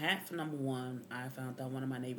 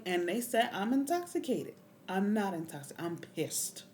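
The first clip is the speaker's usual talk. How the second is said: raised, higher voice in heated protest; angry yelling; 6 semitones higher, 8 dB louder than usual. Retold or imitated. Detainee denies being drunk to bystanders, angry yelling. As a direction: yell it back, outraged at the accusation. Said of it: "I'm yelling and screaming".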